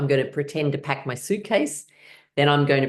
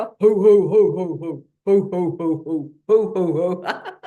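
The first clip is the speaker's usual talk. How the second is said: hearty ho-ho-ho, louder at first; Santa laugh; 4 semitones higher, 5 dB louder than usual. Shown as a demonstration. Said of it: "a bit like Santa".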